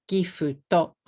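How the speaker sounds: "Gifuto" in three beats, gi-fu-to; katakana English. Shown as a demonstration. Teacher showing too many syllables, katakana English. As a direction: GIFT Japanese-style with added u and o. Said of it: "If you teach it in katakana".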